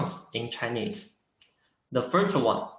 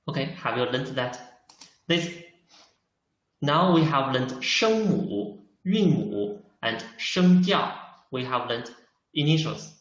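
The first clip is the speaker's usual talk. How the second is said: ordinary talk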